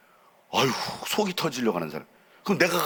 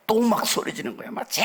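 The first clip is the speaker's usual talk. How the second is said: shouting; 11 semitones higher than usual. Shown as a demonstration. Pastor showing the congregation how he yells, straining. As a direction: yell it out with a hoarse throat. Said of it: "목이 쉬었다고 대충 합니까?".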